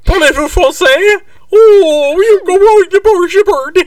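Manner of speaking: very high, loud cartoon French accent; hammed-up French woman squawk; mock French. Joking as the French woman at the payphone, mock French. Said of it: "She's French".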